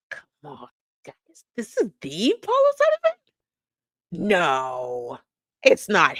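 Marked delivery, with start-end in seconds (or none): 0.1-1.4 whispered. Real, not acted. Hushed aside to viewers. 1.5-4.3 high, slow, loud incredulous question; can't believe what you see; disbelief. Real, not acted. Host reacting to Paul O'Sullivan footage in disbelief.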